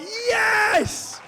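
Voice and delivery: very high, loud shout of joy; triumphant yes. Real, not acted. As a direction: punch the air with it. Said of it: "I'm like".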